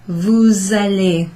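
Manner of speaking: "Vous allez" slow, S said as Z; teacher slowing to show the link; slow liaison. Shown as a demonstration. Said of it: "is to pronounce that S".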